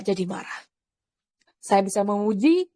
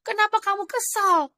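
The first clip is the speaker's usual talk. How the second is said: high-pitched retold remonstrance; puzzled challenge; 8 semitones higher than usual. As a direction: question them in mock disbelief, pitched high. (menegur heran dengan nada tinggi)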